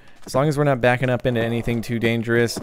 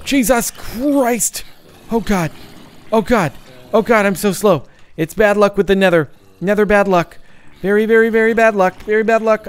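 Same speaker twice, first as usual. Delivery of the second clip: high loud panic; panicked yelling; 7 semitones higher, 5 dB louder than usual. Real, not acted. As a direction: yell it in a frantic rush. Panicked at bad luck.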